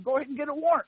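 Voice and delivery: high, cocky dare to the cop; daring the cop, pitched high and taunting. Retold or imitated. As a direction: challenge him to go get it. Defying the cop.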